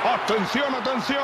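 urgent rising "atención"; rising alert call. Acted. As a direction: call attention, building up. Commentator builds to goal.

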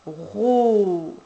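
"ro" loud and drawn out, R held before a long O; insisted syllable sound. Shown as a demonstration. Dictating the next syllable to a child, "ro" insistently stretched. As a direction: stretch both sounds of "ro", swelling on the O. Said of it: "n'hésitez pas à insister".

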